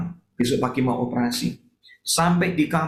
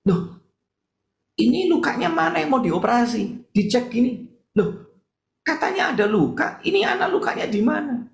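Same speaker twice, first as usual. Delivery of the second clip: higher, puzzled exclamations; surprised doctor; 10 semitones higher than usual. Retold or imitated. Doctor amazed the wound is gone, higher surprised voice. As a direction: sound baffled at the missing wound. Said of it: "dokternya kaget".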